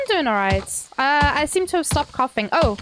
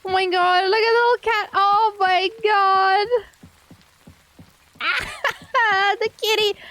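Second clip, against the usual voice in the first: gushing; 6 semitones higher than usual. Real, not acted. Gushing over the little cat.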